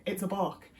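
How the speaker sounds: normal talking voice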